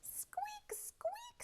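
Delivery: tiny high whispered squeak; squeaky mouse. Acted. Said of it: "said Mouse".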